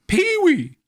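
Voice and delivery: high, loud whiny voice; mock squeaky friend. Offended friend naming the doll, high squeal. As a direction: squeal the name.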